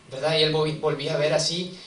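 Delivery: ordinary delivery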